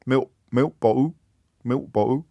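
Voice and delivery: "milk bottle" as miwk bo'ow, tried out repeatedly; dropped consonants. Shown as a demonstration. Host practising estuary English, "milk bottle" with dropped consonants. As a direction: drop the t in "milk bottle", soften each l into a vowel. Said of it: "dropped consonants".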